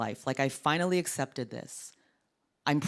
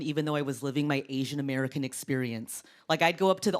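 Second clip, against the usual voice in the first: ordinary talk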